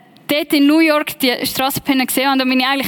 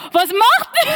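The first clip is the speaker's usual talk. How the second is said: retold startled reaction; 9 semitones higher than usual.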